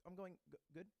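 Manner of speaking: feebly stumbling over the answer; stunned stammer. Retold or imitated. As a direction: stammer it out as if stun-locked. Retells his stunned, stammering reply.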